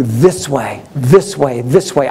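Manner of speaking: low, steady heavenly call. Retold or imitated. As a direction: call them over in a deep, measured voice. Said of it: "given a voice from heaven".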